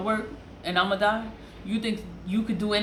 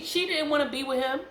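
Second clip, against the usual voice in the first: high, climbing insistence, rising at the end; insistent; 8 semitones higher than usual. Real, not acted. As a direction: press the point with pitch climbing. First take, then restated for emphasis.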